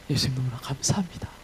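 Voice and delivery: hushed, awed gratitude; whispered prayer. Acted. Paul in prison whispering thanks to Jesus.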